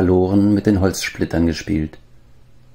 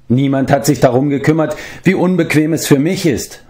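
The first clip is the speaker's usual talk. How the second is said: higher, whiny sulk; grumbling; 8 semitones higher than usual. A narrator's reading. Former giant complains of his discomfort, high-pitched grumbling. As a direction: grumble as one hard done by. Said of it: "maulte Glev".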